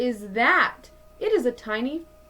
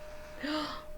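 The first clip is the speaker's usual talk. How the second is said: soft gasp; 6 semitones higher, 12 dB quieter than usual. Acted. Scorpion appears.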